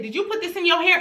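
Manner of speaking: high-pitched accusing question; stern mom interrogation; mama checking her kid. Retold or imitated. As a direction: question your child like you already know. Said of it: "this is how I talk to mine".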